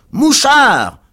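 very loud, high jeering yell; crowd's howl. A narrator's reading. Angry crowd accuses Pickwick, howling. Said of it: "hurla la foule".

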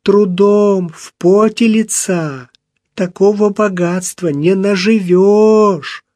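aunt crying and reproaching her nephew; tearful reproach. A narrator's reading. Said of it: "с плачем спросила она".

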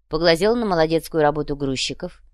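usual speaking voice

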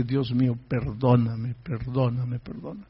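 low, soft plea fading away; hushed retold repentance; contrite prayer. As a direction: beg forgiveness quietly, almost to nothing. Retold prayer begging God's forgiveness.

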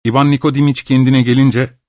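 usual speaking voice

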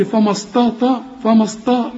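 mock the mistake, thickened فما استطاع; mimicked wrong heaviness. Teacher imitates the student's error, wrong heaviness. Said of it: "زي ما قلت".

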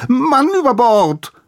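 high, loud shriek of horror; horrified scream. A narrator's reading. Giselher corrects his cry as the priest drowns, shrieking. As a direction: yell the alarm in panic. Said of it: "sortierte die Buchstaben erst im zweiten Versuch".

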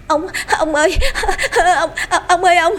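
high, gasping panic; panting, out of breath. A narrator's reading. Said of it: "thở hổn hển, nói không ra hơi".

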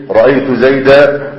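slow رأيت زيدا, zeida ending on open a; زيدا stopped on its alif, slowly; pause with alif. Shown as a demonstration. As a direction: draw out زيدا and land on a long final a. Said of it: "ويقفون عليه بالألف".

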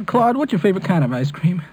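ordinary talk, nothing marked

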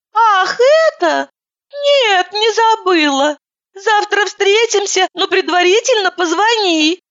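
high, slow, sing-song lady's voice; high-pitched woman on the phone. Acted. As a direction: play her as a theatrical, fluttery woman. Vlasova's voice on the phone.

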